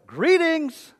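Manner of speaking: high, loud sing-out hello; hammed-up incredulous imitation of a greeting; mock greeting. Preacher doubts Jesus really said it so, mock greeting. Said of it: "did Jesus really go".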